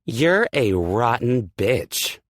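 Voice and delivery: high, loud, slow spitting rage; furious insult. Acted. Aspen insulting Hurricane, shouting in rage. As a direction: hurl it at her, seething.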